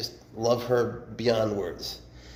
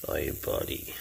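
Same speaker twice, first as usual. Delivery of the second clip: usual speaking voice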